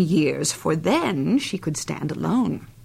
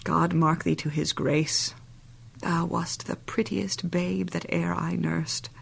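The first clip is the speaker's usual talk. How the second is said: softer, hushed fond blessing; tender whisper; 2 semitones lower, 7 dB quieter than usual. Acted. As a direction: breathe it over her like a lullaby. Nurse blesses Juliet fondly.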